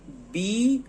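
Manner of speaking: usual speaking voice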